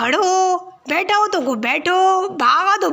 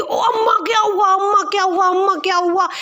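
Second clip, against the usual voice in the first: alarmed; 4 semitones higher than usual. Acted. Daughter waking alarmed.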